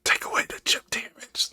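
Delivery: whisper. Pretend. Mock whisper to the host.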